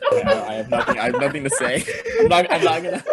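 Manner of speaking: high, helpless laughing speech; talking through laughter. Real, not acted. Refusing the co-host's question, helplessly laughing. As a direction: try to get words out while cracking up. Cracking up at vibrator remark.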